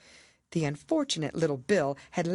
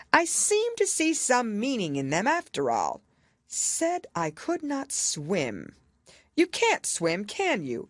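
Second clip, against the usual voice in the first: pompous king; 8 semitones higher, slower than usual. A narrator's reading. King explaining the handwriting to the jury, pompous. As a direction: declare it like a self-important king.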